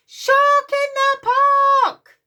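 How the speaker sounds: high, long shouted cry; big shout. Acted. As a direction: call it at the top of your voice. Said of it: "He shouts".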